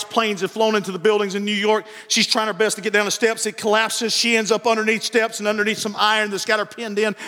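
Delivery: ordinary talk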